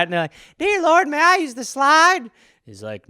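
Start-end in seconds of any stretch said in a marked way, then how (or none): 0.6-2.3 put-on high, loud pious little kid; mock child prayer. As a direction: pray sweetly like a small child. Kids praying for the slide.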